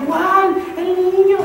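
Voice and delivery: high shocked "¿Cuál?"; astonished. Retold or imitated. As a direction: react as if you can't believe it. Her own astonished reaction.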